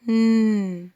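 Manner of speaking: ن as a long held "nnn"; sound of N. Shown as a demonstration. Teacher showing how N is pronounced, held sound. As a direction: sustain ن through the nose. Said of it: "حرف ال ان بتنطق".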